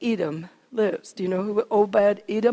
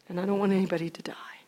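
fast, hushed whisper; grave whisper; 4 semitones lower, 8 dB quieter, much faster than usual. Acted. David whispering to his men that nobody should die. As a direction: drop it to a fearful hush.

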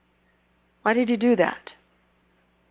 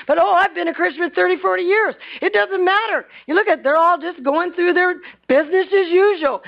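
much higher, faster impassioned preaching; rising fervor; 7 semitones higher than usual. Acted. Preacher stirring the congregation, higher fervor. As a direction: build the heat of the sermon.

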